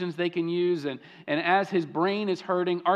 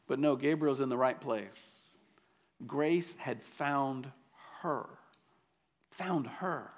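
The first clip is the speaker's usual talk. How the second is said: hushed emphasis; 6 semitones lower, 8 dB quieter than usual. Acted. Dramatic hush in sermon.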